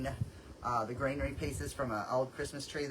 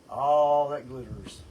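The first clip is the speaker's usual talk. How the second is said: loud, stretched ALL in "All that glitters"; slow, loud marvel at "All that glitters"; drawn-out exclamation; 9 dB louder, much slower than usual. Real, not acted. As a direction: draw "All" out long and loud. Exclaims over the mess of glitter.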